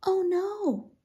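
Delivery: high, drawn exclamation; hooting. A narrator's reading. Willa reacts to her discovery, hooting. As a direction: hoot it with a lifted pitch. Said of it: "Willa hooted".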